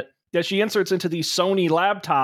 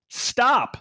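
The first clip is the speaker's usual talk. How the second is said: higher-pitched emphatic movie line; one punched, higher word; quoted exclamation; 6 semitones higher than usual. Acted. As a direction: deliver it as a sudden command. Quoting film line.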